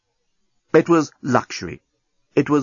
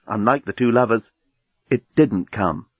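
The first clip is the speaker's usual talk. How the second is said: plain reading voice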